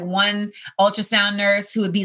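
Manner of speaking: ordinary delivery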